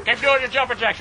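ordinary talk, nothing marked

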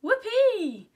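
very high, loud whoop of delight; joyful yell. A narrator's reading. Girl just invited to the sleepover, joyful yell. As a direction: yell it out in glee, drawing it out. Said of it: "I yelled".

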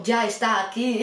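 slow, drawn-out mock-ominous announcement; sinister surgeon. Surgeon announcing he is ready, mildly sinister. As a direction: stretch it out with a touch of menace. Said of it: "sonó todo muy tenebroso".

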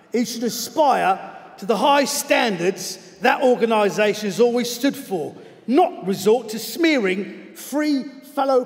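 slightly cockney letter reading, emphatic. Acted. Football coach denouncing a TV presenter by letter, in a cockney accent. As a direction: read it out as an indignant cockney. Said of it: "I'm gonna do it in his voice".

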